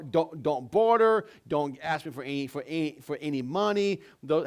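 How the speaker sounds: usual speaking voice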